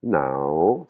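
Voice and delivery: bend "Nao" down and swing it up. Shown as a demonstration. Teacher repeats the blue word, contoured tone on "Nao". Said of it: "une intonation qui monte et qui descend".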